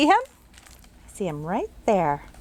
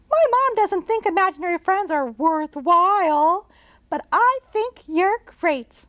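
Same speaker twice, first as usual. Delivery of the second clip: put-on much higher girl's voice; bright little girl; 9 semitones higher than usual. Jane greeting the ghost she can see, as a bright girl. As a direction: chirp it like a curious girl.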